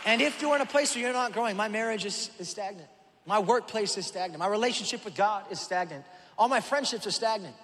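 fired-up pulpit delivery; preaching. Acted. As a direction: preach it out to the whole room. Opening of the sermon.

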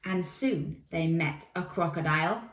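ordinary delivery, nothing marked

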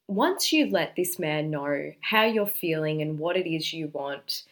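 ordinary talk

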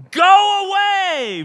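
high, drawn-out demonic scream. Acted. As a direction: cry out in a long, loud shriek. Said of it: "this voice screams out".

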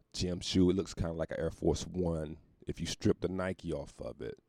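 usual speaking voice

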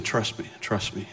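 slow, very soft reassurance; quiet voice of God. Retold or imitated. God just saying trust, quietly. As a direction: murmur it slowly and gently. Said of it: "But God's just saying".

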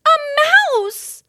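very high, loud panicked cry; frightened mother screams out; alarmed shriek. A narrator's reading.

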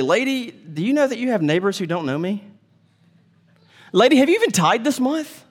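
sarcastic finger-wagging voice; mock nagging. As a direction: nag her self-righteously. Mock preachy Jesus scolding widow.

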